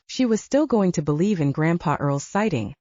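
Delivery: ordinary delivery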